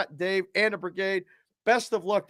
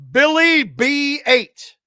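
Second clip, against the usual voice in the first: drawn-out name call; 6 semitones higher, 9 dB louder, much slower than usual. Acted.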